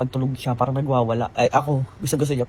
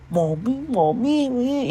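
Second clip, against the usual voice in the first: put-on high wailing child's cry for mommy; imitating crying toddler; 10 semitones higher, much slower than usual. Toddler sent back to seat wailing for mommy, high imitated crying. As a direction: mimic a toddler sobbing for mom, pitch climbing. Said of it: "Iyak-iyak siyang ganun".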